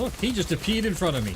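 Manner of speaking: sudden high, loud alarm; surprised outburst. Real, not acted.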